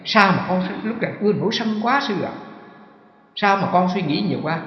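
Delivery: a hint of a whining student; whining disciple. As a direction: mimic a student's whiny complaint. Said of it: "quý vị thường nói".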